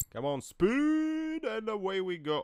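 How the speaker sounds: high, slow drawn-out call; egging the car on with a long high cry; playful rallying call; excited cheer. Real, not acted. Urging on a racing car.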